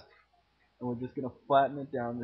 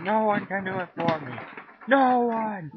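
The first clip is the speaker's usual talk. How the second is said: rising to a high, loud wail; exasperated outcry; 9 semitones higher, 7 dB louder than usual. Real, not acted. Hungry teen complaining nobody cooks, rising to a loud cry. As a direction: build up to an outraged cry.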